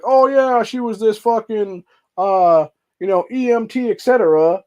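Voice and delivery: high, sarcastic mock-praise; mocking the media. Vlogger mocking media praise of Breonna Taylor, high sarcastic. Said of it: "They're like".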